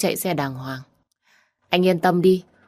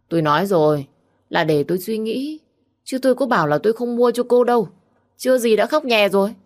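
exasperated teasing; 6 semitones higher, 4 dB louder than usual. A narrator's reading. Đăng teases her crying.

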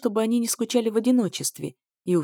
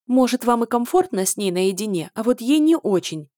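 challenging; 3 semitones higher, 5 dB louder than usual. A narrator's reading. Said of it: "с вызовом бросила я".

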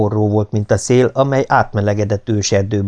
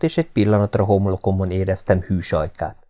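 usual speaking voice

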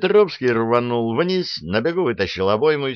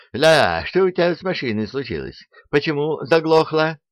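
higher-pitched exasperated shout; angry yell; 7 semitones higher, 3 dB louder than usual. A narrator's reading. Officer to a hapless mechanic over the stalled vehicle, yelling. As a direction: demand an answer, raised and urgent. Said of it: "заорал он на незадачливого механика".

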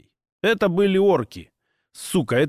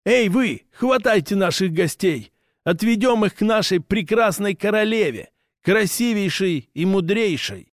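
proud orc voice; 6 semitones higher than usual. Acted. Orc orders his men.

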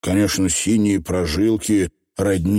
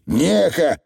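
squeaky little Scottish pixie; high, rough tiny rascal; Feegle voice; 8 semitones higher than usual. Acted. Feegle voice from under bed.

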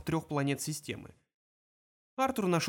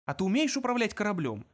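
higher-pitched, courteous question; polite inquiry; 5 semitones higher than usual. A narrator's reading. Ford asks Zaphod about piloting, politely. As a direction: ask with mild, civil curiosity. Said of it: "вежливо поинтересовался Форд".

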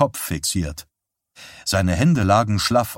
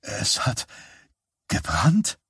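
hushed, halting disbelief; can't take it in; incredulous; 4 dB quieter, slower than usual. A narrator's reading. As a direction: son asking his mother, stunned and disbelieving. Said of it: "Chucks Stimme klang ungläubig".

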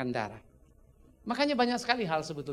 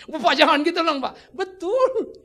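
laughing protest; 9 semitones higher, 10 dB louder, slower than usual. Retold or imitated. Smoker's friend protesting, laughing.

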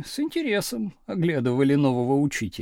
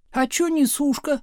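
higher-pitched cheeky call; heckling call; 7 semitones higher than usual. A narrator's reading. Back-row pupils heckling new teacher, called out high. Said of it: "крикнули с задних парт".

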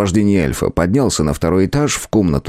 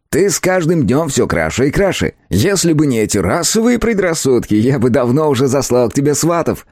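lightly raised, cheeky elf voice; christmas elf; 7 semitones higher than usual. A narrator's reading. Elf character voice.

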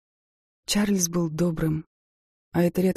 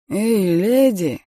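put-on slow, loud drunken holler; drunk man calling out; 3 semitones higher, 7 dB louder, much slower than usual. A narrator's reading. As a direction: call out like a street drunk. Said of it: "окликнул меня с улицы какой-то пьяный".

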